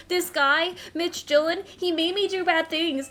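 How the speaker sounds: put-on high whiny baby voice, pushed loud. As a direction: whimper it like a scared little kid. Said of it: "went into, like, baby voice right there".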